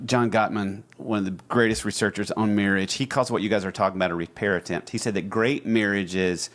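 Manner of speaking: usual speaking voice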